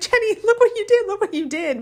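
high, fast playful scold; family teasing the incense-keeper in a high voice; mock scolding. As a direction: tease her like a guilty kid. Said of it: "They were like".

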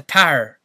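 "tire" flattened into "tar"; one-syllable Southern "tire", no glide; mock Southern "tire", rhyming with car. Shown as a demonstration. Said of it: "in the South".

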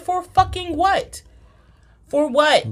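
high, slow demand; mock outrage. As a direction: stretch out the indignant question. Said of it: "I said".

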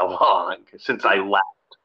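normal talking voice

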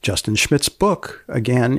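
usual speaking voice

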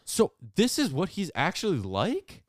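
surprised. Real, not acted. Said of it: "mock surprised question".

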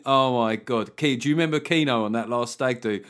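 ordinary talk

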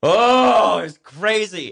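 loud, high, drawn-out "oh"; amazed exclamation. Real, not acted. Recalling the crazy day to the co-host, loud amazed exclamation. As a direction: burst out, overwhelmed by how wild it was.

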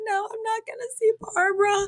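very high, whiny nasal-free wail of no; whiny Fran refusal. Retold or imitated. Retelling the episode, Fran refuses to go, very high whine.

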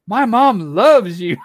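drawn-out loud fan line; loud slow quote. Retold or imitated. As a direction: belt it out slowly. Girl's retold line, loud and slow.

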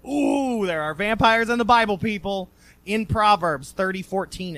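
loud, thrilled announcement; excited. Real, not acted. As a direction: burst out with the big reveal. Excited reveal of vampires.

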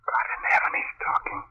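breathy whisper rising to a choked cry; awestruck whisper. Acted. Chuck sees the ghost speak, horrified whisper. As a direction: gasp it in horrified disbelief.

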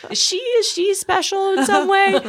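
high sneering laughing voice; mock them up high; mocking passerby. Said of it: "They're like".

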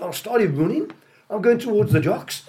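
normal talking voice

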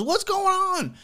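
put-on girlfriend voice. His old lady arriving and asking what's going on, high falsetto.

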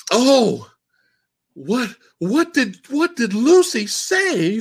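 put-on high, slow, urgent old woman voice; cult leader. Cult leader demanding what the martyr said, high slow urgent voice. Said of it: "she shows up and goes".

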